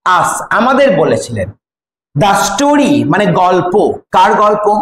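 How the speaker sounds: ordinary delivery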